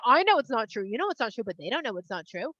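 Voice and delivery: ordinary delivery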